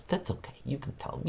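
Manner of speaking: hushed, whispered aside; soft, secretive reassurance; confiding whisper. Pretend.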